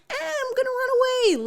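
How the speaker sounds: high-pitched, quick mocking voice; mock whiny runaway. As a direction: squeal it as a silly impression of someone fleeing. Mocks character's threat to flee.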